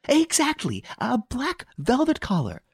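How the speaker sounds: lady triumphing over the commissary; triumphant. A narrator's reading. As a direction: crow over being proven right. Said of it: "exclaimed Madame Renaud triumphantly".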